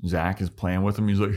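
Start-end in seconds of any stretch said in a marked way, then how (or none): none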